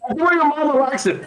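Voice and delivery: high mocking taunt. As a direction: jeer at the guard. Player taunts while whipped.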